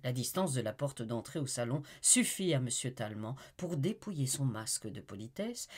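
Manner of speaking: ordinary delivery, nothing marked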